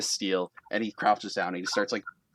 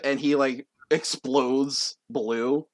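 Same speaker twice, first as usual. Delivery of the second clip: usual speaking voice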